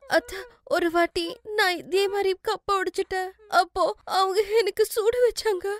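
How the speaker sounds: high, whimpering confession; tearful. Acted. Daughter-in-law telling mother-in-law of the burning, tearful. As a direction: tell it through tears, still scared. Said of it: "எதுக்கு அழுதுட்டு இருக்க?".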